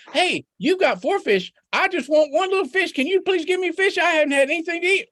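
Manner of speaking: high, pleading little rabbit voice; begging in a high squeaky voice; Rabbit's voice. Acted. Rabbit begs Fox for a fish, high and pleading.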